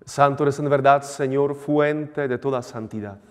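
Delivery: measured priestly recitation; formal liturgical prayer. Acted. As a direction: intone it like a priest at mass.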